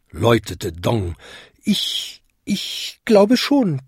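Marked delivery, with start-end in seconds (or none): 1.6-3.8 high, slow, hesitant little-bell voice; embarrassed little bell. A narrator's reading. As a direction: stammer it, shy and embarrassed. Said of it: "klingelte Vinzi verlegen". (verlegen, hoch und zögernd)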